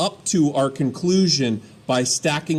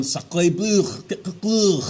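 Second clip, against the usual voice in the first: hammed-up French accent, rising to a high bleu; sacré bleu as a cartoon Frenchman; light fake French accent; mock French exclamation; 4 semitones higher than usual. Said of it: "that's how French sounds to me".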